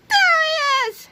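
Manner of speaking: very high, drawn-out shriek; angry old ladies' shout. A narrator's reading. Old ladies spotting Melvin, angry shouting. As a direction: screech it slowly, way up high. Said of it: "shouted a couple of very angry old ladies".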